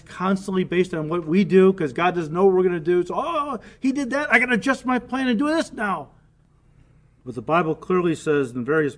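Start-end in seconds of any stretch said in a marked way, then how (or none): 3.2-6.1 mock flustered God. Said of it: "doctrines that believe that God ... has to adjust".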